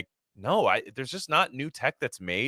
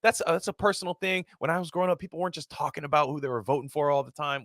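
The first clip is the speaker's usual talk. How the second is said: much higher, lightly put-on smooth voice; 7 semitones higher, 3 dB quieter, faster than usual. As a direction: play the celebrity giving a careful answer, pitched up. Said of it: "because he's like".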